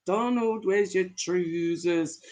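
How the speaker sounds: put-on light Scottish accent, drawn out at the end. Reading a Scottish line to the chat, light Scottish accent. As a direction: try a tentative Scottish lilt. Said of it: "Did I say it in a good Scottish accent?".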